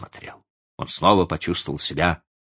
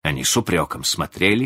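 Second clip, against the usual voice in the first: plain reading voice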